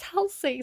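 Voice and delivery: Halsey said high as HAL-see; others' pronunciation. Retold or imitated. Reader imitating everyone correcting her, [name]. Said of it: "then everybody's like".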